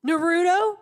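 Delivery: loud, high, excited "Naruto?"; surprised question. Retold or imitated. Shoe clerk shocked to meet Naruto's voice, excited shout. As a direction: shoe clerk recognizing the show, thrilled, loud. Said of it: "And he goes".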